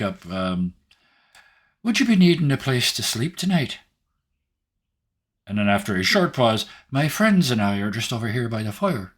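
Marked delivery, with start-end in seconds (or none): 1.8-9.1 high, lilting Irish brogue; a cheery Irish traveller greeting; Irish accent. Acted. Player voices character Barry.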